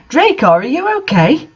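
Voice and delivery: hammed-up goth concern; gothic voice. A narrator's reading. Narrator asks crying Draco, light mock gothic voice. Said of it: "I asked in a gothic voice".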